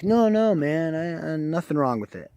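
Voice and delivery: high-pitched imitation of protesting innocence; defensive mock denial. Seller defensively denies a fault.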